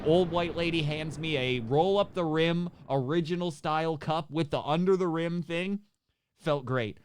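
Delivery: usual speaking voice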